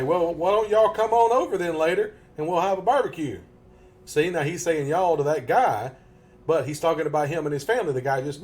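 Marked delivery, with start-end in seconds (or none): 0.0-3.4 hospitable invitation. Acted. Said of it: "other guy might say".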